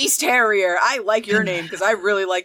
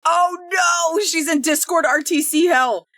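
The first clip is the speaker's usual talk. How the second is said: alarmed shout; 7 semitones higher than usual. Real, not acted. Discord drops the co-host.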